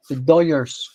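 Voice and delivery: "Dodgers" with the middle turned into a y; Doyers pronunciation. Shown as a demonstration. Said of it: "you gotta pronounce it right".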